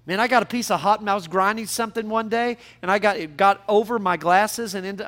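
ordinary talk, nothing marked

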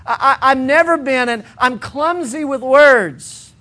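a hint of a begging Moses; pleading Moses. Moses pleading with God, lightly stammering. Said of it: "He's pleading with the Lord".

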